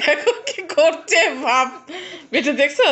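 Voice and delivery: chuckling through the words, slowed down; laughing. Real, not acted. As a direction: laugh while pointing it out. Laughing at the pet's antics.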